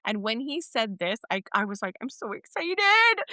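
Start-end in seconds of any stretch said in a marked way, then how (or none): 2.0-3.2 much higher, slow gushing excitement; excited. Retold or imitated. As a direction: squeal it with delight. Retold excitement.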